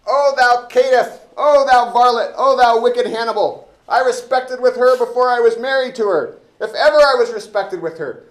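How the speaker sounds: very loud, high indignant shouting; hammed-up furious constable yelling; Elbow's outrage. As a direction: bellow at the rogue who insults your wife. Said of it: "I wanna be elbow".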